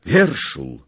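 a loud startled cry of the name; exclaiming. A narrator's reading. Skrzetuski recognizes the muddy officer, loud cry. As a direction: call out the name in shock. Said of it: "Skrzetuski wykrzyknął".

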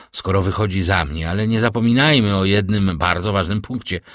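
usual speaking voice

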